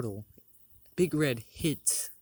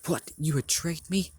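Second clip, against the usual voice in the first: higher, mildly indignant disbelief; shocked question; 6 semitones higher, 4 dB louder than usual. Acted. Zod confronts the leader who betrayed him, shocked question. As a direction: voice lifts on the accusation.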